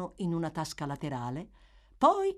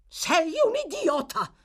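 much higher, louder snapping insult; wife scolding her cowardly husband; angry exclamation; 14 semitones higher, 7 dB louder than usual. A narrator's reading. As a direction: bite his head off. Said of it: "esclamò la signora Bumble".

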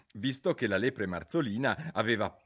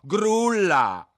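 mocking insult; 11 semitones higher, 10 dB louder than usual. A narrator's reading. Insult flung at Alice.

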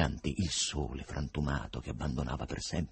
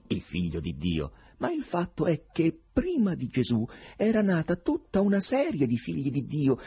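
raised, didactic editor's voice, pitched up; Berlioz lecturing; 7 semitones higher, 3 dB louder than usual. A narrator's reading. Berlioz lecturing young poet Ivan, pitched-up didactic voice. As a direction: explain like a know-it-all editor.

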